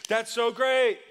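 playful mock delight; mock excitement. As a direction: cheer it like a delighted modern churchgoer. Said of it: "we'd be like".

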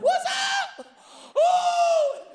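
loud high whooping host greeting; amped-up Ty Penny. Retold or imitated. Imitating the host on the iPad, hyped whoop. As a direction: explode with hyped excitement. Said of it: "Ty comes up on the iPad".

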